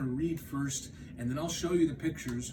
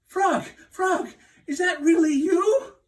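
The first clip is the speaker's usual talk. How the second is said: relieved cry; 12 dB louder than usual. Acted. Toad waking relieved.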